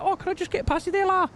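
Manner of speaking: imitating a Scouse shopper asking to get past; bad Scouse accent. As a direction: mimic a Liverpool stranger in Scouse. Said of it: "I'm a terrible at accents".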